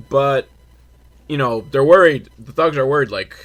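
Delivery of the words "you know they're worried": ordinary talk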